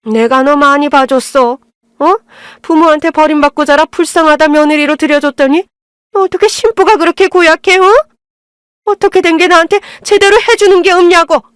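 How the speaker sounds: a hint of a fuming mother-in-law; mother-in-law still fuming, huffing her complaints. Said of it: "씩씩거리셨죠".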